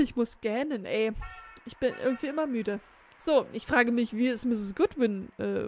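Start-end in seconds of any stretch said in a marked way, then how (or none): none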